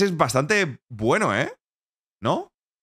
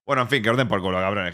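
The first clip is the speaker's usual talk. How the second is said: ordinary talk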